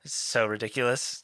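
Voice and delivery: ordinary talk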